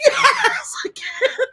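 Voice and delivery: high, loud, laughing yes; laughing cheer. Retold or imitated. Cheering the second guilty count, high and laughing. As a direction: let the win burst out of you.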